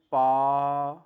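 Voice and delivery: "پا" said loud with a long open aa; loud teacher demo of a word; example word demo. Shown as a demonstration. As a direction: call out "پا" stretching the aa. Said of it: "اب اس کی examples دیکھیں".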